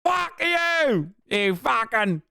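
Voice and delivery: high, drawn-out Australian swearing; mock Australian accent. Streamer cursing the others, exaggerated Australian accent. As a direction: stretch the insult in a hammed-up Australian accent. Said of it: "a term that Australians even use".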